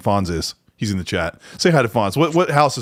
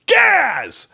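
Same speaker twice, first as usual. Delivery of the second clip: drawn-out higher shout of a name; jokey shout; 12 semitones higher than usual. Acted. Jokey shout reading chat message.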